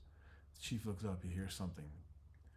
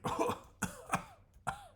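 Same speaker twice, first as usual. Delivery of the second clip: a very high joyful laugh of relief; relieved laugh; 4 dB louder than usual. Acted. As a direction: laugh out, safe at last. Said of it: "He's like".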